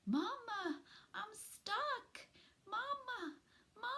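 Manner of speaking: put-on high, faint baby voice; tiny newborn pleading; weak baby possum. A narrator's reading. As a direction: cry out small and weak for mama. Baby possum calling for mother.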